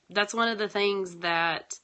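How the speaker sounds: usual speaking voice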